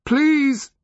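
very high, loud strained please; terrified plea. A narrator's reading. Boy overcome by returning terror, pleading loudly. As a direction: cry please as terror takes over. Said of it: "hissed through clenched teeth against returning waves of terror".

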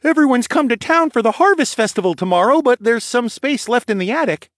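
put-on high, loud boy's voice; sleepy stable boy. A narrator's reading. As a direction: give the news as a young stable hand.

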